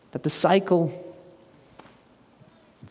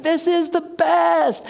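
much higher, slow, gushing delight; mock delight; 13 semitones higher, much slower than usual. Preacher imitating a listener at a high point, gushing delight. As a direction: gush like everything is perfect. Pretend delight in sermon example.

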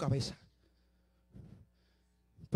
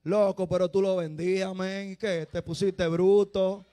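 louder, slow teasing; mock street friend; 10 dB louder, slower than usual. Imagined churchgoer teasing the preacher, loud and slow. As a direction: needle him like a buddy. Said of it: "Dirán algunos".

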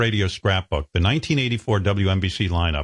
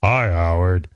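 deep radio voice; 3 semitones lower, 3 dB louder, slower than usual. Retold or imitated. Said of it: "deeper voices than me".

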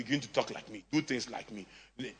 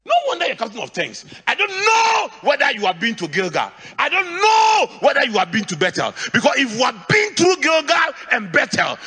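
loud, high preaching shout; shouted rebuke; 11 semitones higher, 8 dB louder than usual. Real, not acted. Pastor shouting at those who refused instructions. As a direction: rebuke them at full force.

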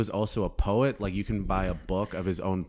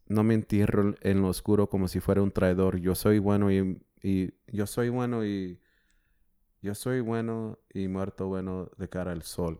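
recited in Spanish. Retold or imitated. Speaker recalls the quote for a friend, in Spanish. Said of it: "how's it in Spanish?".

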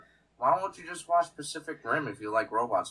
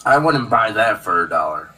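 much louder, punchy quote; loud movie catchphrase; 11 dB louder than usual. Acted. Quoting RoboCop to crack up the co-host, loud. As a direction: belt it out like a film line.

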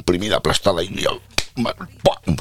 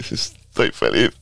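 mock tearful joy with a laugh; hammed-up crying from happiness; happy crying; 4 semitones higher than usual. Answering the girl how it went, mock happy tears. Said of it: "Lloraba, lloraba, pero de alegría".

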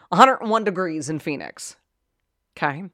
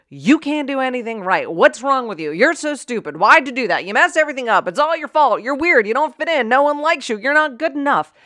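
higher-pitched rapid-fire scolding; berating someone; 6 semitones higher, 4 dB louder than usual. Acted. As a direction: rattle off insults at someone's face. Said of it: "if someone was standing in front of you saying".

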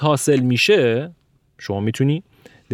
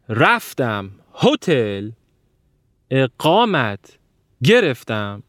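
"رفتم هتل اقامت گرفتم" drawn out slowly, each word pushed; slow, overstressed, word by word; mock word stress; much slower than usual. Shown as a demonstration. Teacher parodying stressed Persian words, slow and pushed. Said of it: "آیا کلمات ما اینجا الان تکیه داره؟".

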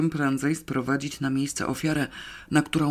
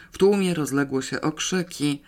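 ordinary delivery

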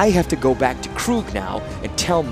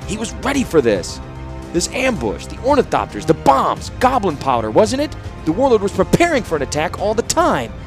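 rising, hard accusing voice; steely; 9 semitones higher, 3 dB louder than usual. A narrator's reading. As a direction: press each point with steel. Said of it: "his voice now filled with steel".

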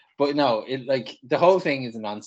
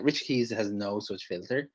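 ordinary talk, nothing marked

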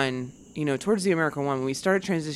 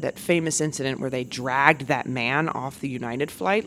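usual speaking voice

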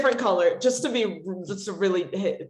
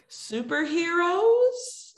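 high, slow questioning pitch; Polly Draper; 7 semitones higher, 3 dB quieter, much slower than usual. Retold or imitated. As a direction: draw it out as a tentative question, imitating the caller. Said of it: "she's like".

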